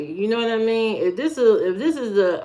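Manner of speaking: usual speaking voice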